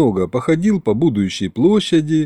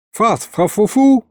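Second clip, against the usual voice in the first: high, slow mouth-full gibberish; talking while chewing; 10 semitones higher than usual. A narrator's reading. Narrator answers with food in mouth, muffled chewing talk. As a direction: mumble high through a big bite. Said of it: "Щас, говорит, прожуёт".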